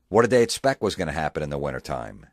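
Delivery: normal talking voice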